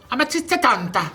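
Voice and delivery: Amatriciana said high with lilting Italian vowels; hammy Italian pronunciation of Amatriciana; Italian accent. Retold or imitated. Showing how Italians say the dish, Italian accent. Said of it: "That's how they talk".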